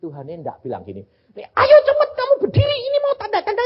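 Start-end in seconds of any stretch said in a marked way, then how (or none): 1.4-3.6 mock impatient God. Imagined God voice, then denial.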